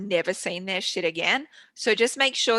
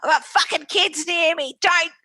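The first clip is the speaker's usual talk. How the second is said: higher, louder, gruff bush dad telling her off; scolding dad; 8 semitones higher, 6 dB louder than usual. Retold or imitated. Dad on the phone to his sister, loud scolding. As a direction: bark the order like a rough country father. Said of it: "And he said".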